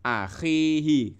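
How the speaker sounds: Akhihi slow, the khi stretched; teacher showing a long vowel. Shown as a demonstration. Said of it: "khi-nya itu panjang".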